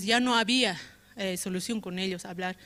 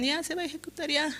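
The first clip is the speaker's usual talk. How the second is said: mock stern boss; 8 semitones higher, faster than usual. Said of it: "o a que me digan".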